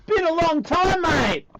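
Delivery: high, drawn-out excited hello; Bindi greeting. Acted. Bindi greets Russell Crowe after a long time, high exclaiming voice.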